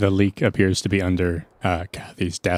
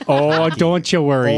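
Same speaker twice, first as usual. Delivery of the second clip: mock Canadian; 8 semitones higher, 7 dB louder, slower than usual. Player mocks Howie's Canadian catchphrase.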